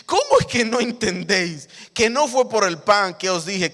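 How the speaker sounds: loud, high exasperated rebuke; Jesus rebuking. Acted. Jesus scolding disciples who forgot the miracle, loud. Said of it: "Se los voy a explicar ahora".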